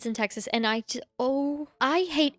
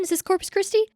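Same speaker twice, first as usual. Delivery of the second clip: excited; 9 semitones higher, 4 dB louder than usual. Real, not acted. Excited guessing at location.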